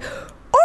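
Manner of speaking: whispered falling "Ooh!" like a slow grenade; breathy falling ooh. Retold or imitated. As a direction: let it fall away like a dropping grenade. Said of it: "No, no, it's like".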